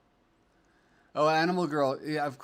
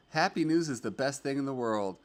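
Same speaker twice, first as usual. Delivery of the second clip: ordinary talk, nothing marked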